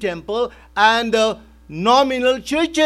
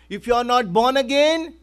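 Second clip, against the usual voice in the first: ordinary talk, nothing marked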